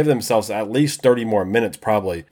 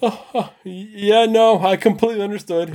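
laughing; 9 semitones higher than usual. Real, not acted. Still laughing after the joke.

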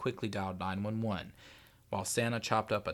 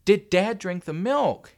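slow, high, loud question; stubborn demand; 11 semitones higher, 12 dB louder, slower than usual. A narrator's reading. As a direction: demand it word by word, loudly. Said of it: "his old stubborn self".

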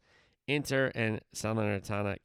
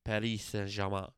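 put-on French accent; 3 semitones lower, 3 dB quieter than usual. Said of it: "I don't do a bad accent".

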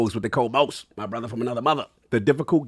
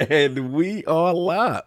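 show announcer; slower than usual. Acted. Slow showman opening of stream.

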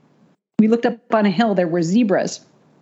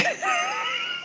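much higher, laugh breaking into a sob; laughing cry; 9 dB quieter than usual. Real, not acted. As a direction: let the laugh crack into tears. Emotional hug after stress.

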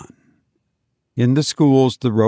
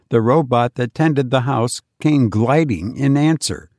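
ordinary delivery

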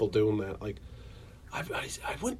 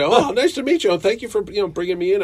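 high, eager thanks; bubbly and grateful; gushing thanks; 7 semitones higher, 4 dB louder than usual. Retold or imitated. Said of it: "they jump to".

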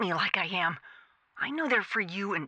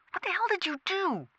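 sharp, higher angry scolding; angry demand; 11 semitones higher than usual. Acted. Manager to operator, sharp angry demand.

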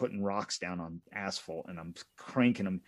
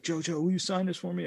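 mock fan yell; 11 semitones higher than usual. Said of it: "I remember him yelling".

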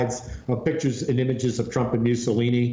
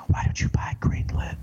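breathy secret whisper in the ear. Retold or imitated. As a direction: whisper it like a scheming adviser. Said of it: "they just whisper in his ear".

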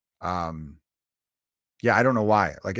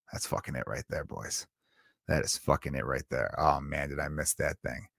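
low, hushed, savoring every word; blissful murmur; 9 semitones lower, 8 dB quieter than usual. Retold or imitated. Telling the guys at the bar it's perfect, low blissful murmur. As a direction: murmur it like heaven in a glass. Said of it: "you're just like".

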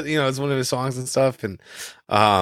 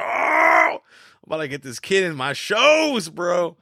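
excited shout; 9 semitones higher than usual. Retold or imitated. Manager bragging about signing the kid, excited shouting. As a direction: shout it like a greedy manager who just found a star. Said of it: "Tom Hanks like".

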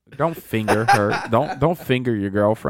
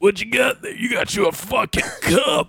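put-on rough cowboy drawl, pitched up; John Marston impression; 9 semitones higher, slower than usual. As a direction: strain the throat like a game cowboy. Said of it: "That man talks like that".

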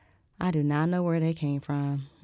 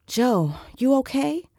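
louder, climbing higher on okay; worried question; 9 semitones higher, 5 dB louder than usual. A narrator's reading. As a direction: check on her with sudden alarm. Jason checks on her.

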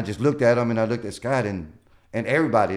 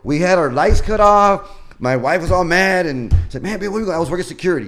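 excited; 7 semitones higher, 6 dB louder than usual. Real, not acted. Excited telling of hard times.

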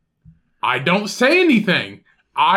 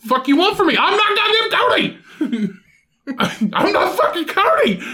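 mock indignant retort; 12 semitones higher than usual. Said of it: "and I'd be like".

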